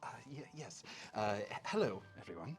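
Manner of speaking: halting, stammering posh rabbit with polite hesitations; flustered posh manager. Acted. Hotel manager correcting and welcoming guests, flustered posh voice. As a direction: politely cover annoyance in a posh accent. Game master voices Mister Zenith.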